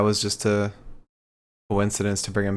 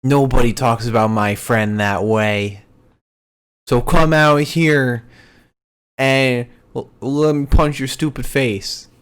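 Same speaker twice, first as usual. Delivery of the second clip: louder hammed-up call-out; mock wrestling promo; 5 dB louder than usual. Retelling Adam Cole calling out Austin Theory, louder mock promo. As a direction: bark a taunt into the ring. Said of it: "Adam Cole talking in the ring, basically like".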